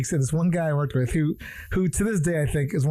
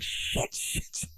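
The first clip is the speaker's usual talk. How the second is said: whispered swearing, much softer; muttered curse; 10 dB quieter, much slower than usual. Retold or imitated. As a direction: hiss it under your breath. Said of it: "She's like".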